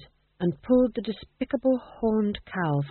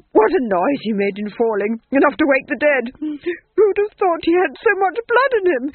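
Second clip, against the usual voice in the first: high, loud unhinged ranting; raving duke; 8 semitones higher, 8 dB louder than usual. Acted. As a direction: babble it like a madman.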